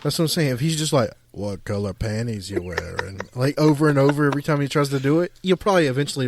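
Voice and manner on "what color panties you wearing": very low, slow, soft impression; Morgan Freeman. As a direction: draw it out in a deep, hushed old man's voice. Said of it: "do the Morgan Freeman voice".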